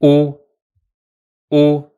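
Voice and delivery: "o" as a short clear vowel; vowel sound drill. Shown as a demonstration. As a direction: say "o" briefly, twice. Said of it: "so we add the sound".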